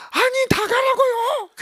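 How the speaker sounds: high, slow, drawn-out mock insistence; insistent doctor. Doctor telling husband again to leave, high slow insistence. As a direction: spell it out to a pest.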